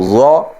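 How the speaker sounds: ظا said thick and heavy, no whistle. Shown as a demonstration. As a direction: make ظا full and heavy. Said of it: "اس کو موٹا پڑھتے ہیں". (ظا کو موٹا پڑھیں)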